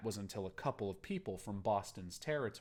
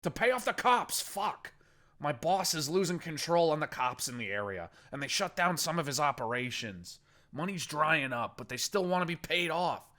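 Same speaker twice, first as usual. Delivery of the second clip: higher, louder, pained confession; crying through pain; 7 semitones higher, 5 dB louder than usual. Acted. Injured captive confessing to Boston, crying through pain. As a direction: blurt it out in agony. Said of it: "he cried through the pain".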